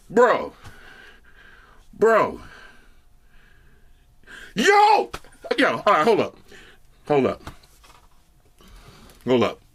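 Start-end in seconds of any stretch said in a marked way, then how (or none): 4.5-5.1 excited yo. Real, not acted. Hyped by the bass.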